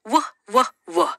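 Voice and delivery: "w" said as a short "wuh"; isolated w sound. Shown as a demonstration. Teacher showing what W and H make together, isolated wuh. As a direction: repeat "w" as separate "wuh" sounds, not the letter name. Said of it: "you only hear the sound w".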